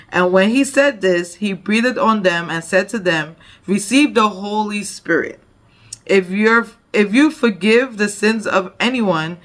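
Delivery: scripture reading. Acted. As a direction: proclaim the verses to the congregation. Reads scripture aloud.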